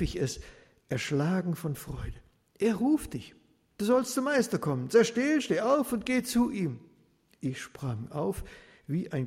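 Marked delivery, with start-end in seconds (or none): 2.6-6.8 high, louder, eager voices; excited calling. Acted. Crowd telling the beggar Jesus calls him, excited. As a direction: tell him the news with eager urgency. Crowd relays the master's call.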